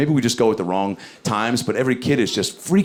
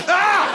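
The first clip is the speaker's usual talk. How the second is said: sudden high yelp; startled scream; 3 dB louder than usual. Retold or imitated. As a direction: yelp as you trip. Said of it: "and I was like".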